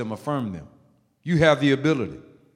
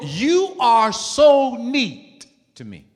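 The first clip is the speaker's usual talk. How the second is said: slow, higher, drawn-out praise; emphatic affirmation; 7 semitones higher, 7 dB louder, much slower than usual. Shown as a demonstration. Preacher showing how to compliment someone, slow and emphatic. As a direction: stretch every word of the compliment.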